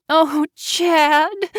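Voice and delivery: high, anguished cry of despair. A narrator's reading. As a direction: call his name out in despair. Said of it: "she cried out loud".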